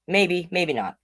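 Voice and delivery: usual speaking voice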